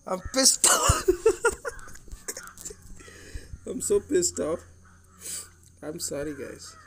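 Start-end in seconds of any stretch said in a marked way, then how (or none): 0.1-1.1 much higher, slow, strained squeal; squealing rant. Real, not acted. Gamer angry over card pulls. 1.1-3.6 breathless, unvoiced giggle; a hushed, airy laugh; whispered laugh. Real, not acted. Laugh right after squeal.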